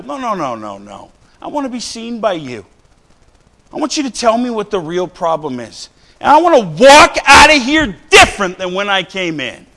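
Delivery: rising sermon fervour; fervent preaching. Acted. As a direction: preach it out to the pews. Preaching to congregation.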